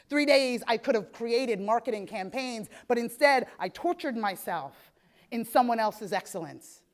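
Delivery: normal talking voice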